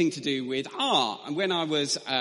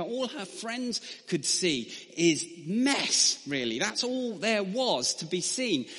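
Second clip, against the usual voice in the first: ordinary talk, nothing marked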